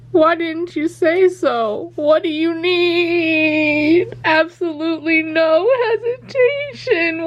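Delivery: high, slow, near tears; gushing. Real, not acted. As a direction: swoon over the line. Gushing over Wylan line.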